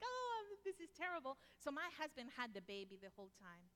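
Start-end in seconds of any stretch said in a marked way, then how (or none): 0.0-1.4 high, mock-panicked wail; scared mom. A mother fretting in the emergency room, high mock panic. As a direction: play the frightened mom in the hospital. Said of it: "I'm the chicken in the house".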